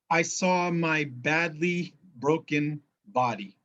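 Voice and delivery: usual speaking voice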